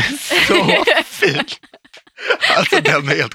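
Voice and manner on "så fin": high, slow, loud gushing; drawn-out gushing praise. Real, not acted. Praising her friend's lovely apartment, gushing slowly and high.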